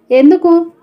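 understated anxiety, pitch lifted; anxious question. A narrator's reading. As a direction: ask it with a touch of alarm. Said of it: "అంది ఆందోళనగా".

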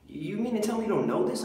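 indignant disbelief. Acted.

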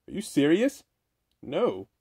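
ordinary talk, nothing marked

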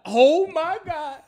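high, loud, drawn-out mock amazement; starstruck outburst. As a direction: stretch it out, overwhelmed and loud. Reacts to President saying his name.

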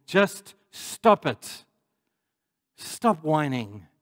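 slow, weighted command; firm rebuke. Real, not acted. Preacher telling whiners in the congregation to stop, slow firm rebuke. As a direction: lay each word down slowly and firmly.

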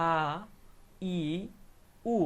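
আ, ই, উ as separate long vowels; pronunciation demo. Shown as a demonstration. Teacher sounding out three alif vowels together, pronunciation demo. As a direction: sound out আ, ই, উ one by one. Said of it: "যদি আমি উচ্চারণ করি".

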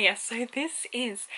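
ordinary talk

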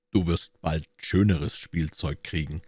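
ordinary delivery, nothing marked

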